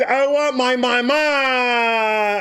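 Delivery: high, slow wail for mama; a kid bawling, stretched out; mock crying.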